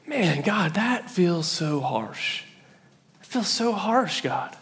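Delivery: soft, drawn-out plea to God; softly aggrieved, stretching the words; dismayed protest. Acted. Voicing dismayed reader to God.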